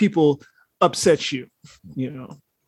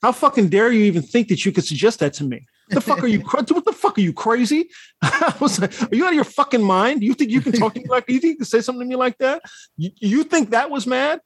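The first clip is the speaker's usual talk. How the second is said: higher, fast indignant rant; hammed-up outrage at the suggestion; retelling how he nearly lost it, mock furious; 5 semitones higher than usual. Retold outburst at suggestion.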